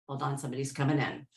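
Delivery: quick, low, hushed aside; quiet aside. Real, not acted. Host breaks off reading as someone joins, quiet aside. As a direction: drop the voice and slip it in fast.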